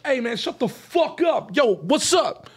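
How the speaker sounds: high, acted gangster shout; yelling. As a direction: yell at someone in the background, then at the caller. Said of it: "be yelling at somebody in the background".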